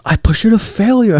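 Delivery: very high-pitched mock-excited bro; hammed-up delighted realization; elated epiphany. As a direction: squeal it like a thrilled gym bro. Gym bro repeats his epiphany.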